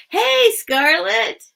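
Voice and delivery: high, slow sing-song hello; cheery greeting. Real, not acted. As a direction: call out a bright welcome. Greeting a viewer warmly.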